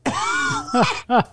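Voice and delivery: high burst of real laughter. Real, not acted. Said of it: "laughs at own story".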